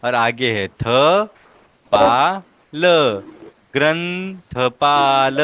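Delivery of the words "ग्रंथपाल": ग्रंथपाल drawn out very slowly, syllable by syllable; slow demo. Shown as a demonstration. Teacher shows how to read the word, slow demo. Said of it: "ऐसे".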